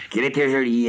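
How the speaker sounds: plus aigu, plus fort, en extase; s'exclamer, ravi, sur « était »; émerveillé. A narrator's reading. Répétition exaltée de l'exclamation. (exclamation émerveillée)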